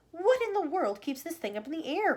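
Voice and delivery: put-on croaking old grandma. A narrator's reading. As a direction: croak it out in disbelief. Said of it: "croaked Grandma Josephine".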